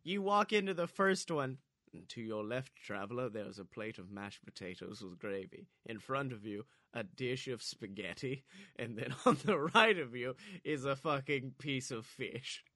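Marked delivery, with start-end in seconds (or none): 2.1-12.6 low, hushed mystical guide voice; deep narrator voice. Pretend. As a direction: intone it like an ominous gatekeeper. Narrating a food maze as guide.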